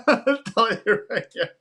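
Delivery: high, laughing voice. Real, not acted. Streamer joking that his friend won't buy again, laughing. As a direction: talk through the laughter.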